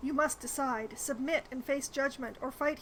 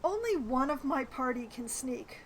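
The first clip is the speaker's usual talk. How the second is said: ordinary delivery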